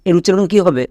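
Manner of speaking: ordinary delivery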